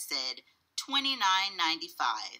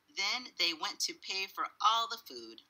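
ordinary delivery, nothing marked

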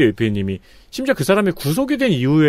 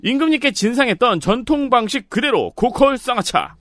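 high bright sales-pitch read; infomercial; 10 semitones higher than usual. Shown as a demonstration. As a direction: sell it like a TV ad. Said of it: "저는 이제 톤으로 알려드리는 거죠".

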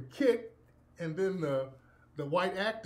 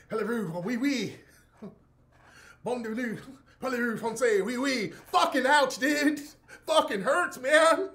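bad French accent, turning into high loud pain; hammed-up French then yelping in pain; mock French; 6 semitones higher, 5 dB louder than usual. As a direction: fake French accent breaking into cursing. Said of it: "You're supposed to be French".

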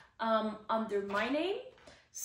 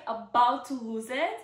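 ordinary delivery

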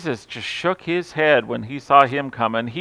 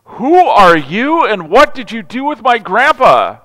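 stunned disbelief; 7 semitones higher, 6 dB louder than usual. Retold or imitated. Grandson amazed at grandpa baby talking, stunned. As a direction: gasp it out in mock shock. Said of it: "and I'm like".

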